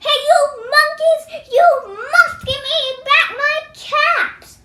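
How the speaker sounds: high, fast angry cry. Acted. Angry peddler crying out at the monkeys, high and fast. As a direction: squeal at the monkeys in fury. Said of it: "very, very angry".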